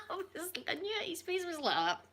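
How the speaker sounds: said through high laughter; laughing. Real, not acted. Retelling the haggis confession, laughing. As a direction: giggle the words out.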